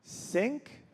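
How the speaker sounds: meek one-word question; timid suggestion. Retold or imitated. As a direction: offer it cautiously, pitching up. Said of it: "And then I said".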